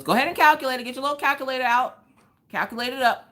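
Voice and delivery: mom taunting. Retold or imitated. Mom taunting her to calculate.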